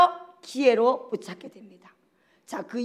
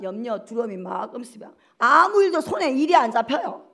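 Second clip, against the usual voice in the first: ordinary talk, nothing marked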